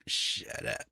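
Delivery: low, breathless whisper of shut up. Retold or imitated. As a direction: hiss it under the breath, retold. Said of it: "I'm like".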